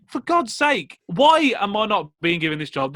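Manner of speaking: high indignant retold complaint; mock exasperation. Retelling the job rejection, high mock exasperation. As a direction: throw your hands up in disbelief.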